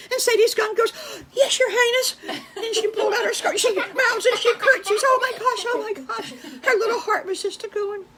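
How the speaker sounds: high, loud, breathless storytelling; flustered excitement. A narrator's reading. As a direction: gush in a high-pitched fluster. Narrator and skunk flustered over royalty.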